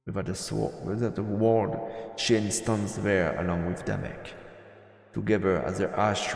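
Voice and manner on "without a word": ordinary delivery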